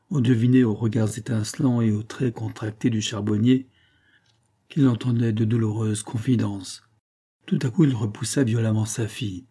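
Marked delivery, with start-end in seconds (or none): none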